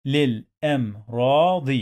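للأمراض in separate syllables, long "raa"; sounded out. Shown as a demonstration. Teacher drilling learners on reading, sounded-out للأمراض. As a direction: break للأمراض into lil, am, raa, di.

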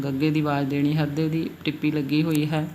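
ordinary talk, nothing marked

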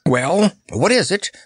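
high, eager question; puffing eagerness. A narrator's reading. Mustafa wanting the chamberlain's news, puffing eagerly. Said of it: "puffed Mustafa eagerly".

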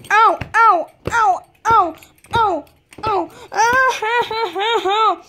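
high play-acted ow cries; mock pained yelps. Pretend-spanked kid yelps to the parent, high mock ow. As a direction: fake the pain of a spanking.

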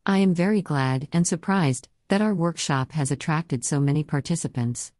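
synthesized text-to-speech voice; computer-generated voice. Real, not acted. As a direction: speak like a computer voice reading text. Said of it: "I use a computer-generated voice, as you can hear now".

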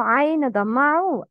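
normal talking voice

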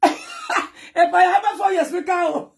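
name said through laughter; laughing. Real, not acted. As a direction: laugh it out high. Laughing at the clip.

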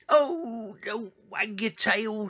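high, slow shrieking plea. A narrator's reading. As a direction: shriek in alarm, stretching the words. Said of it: "shrieked her brothers".

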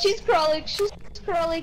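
being chased. Real, not acted. Ghost hunting, team fleeing.

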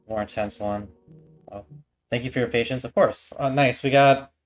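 ordinary talk, nothing marked

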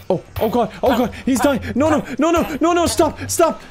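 high, loud rising panic; shrieking stop as it all goes wrong; watching the villager die, real rising panic; panicked cries. Real, not acted. Villager dying in his care.

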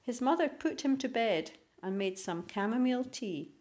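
ordinary delivery, nothing marked

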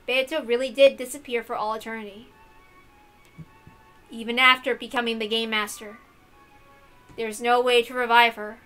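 ordinary delivery, nothing marked

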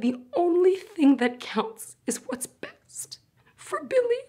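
tragic heroine sorrow. Acted. Emotional peak on Billy.

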